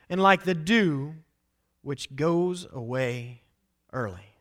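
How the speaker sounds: a touch of solemn declamation; scripture reading. Acted. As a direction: read the verse with weight. Reading scripture verse aloud.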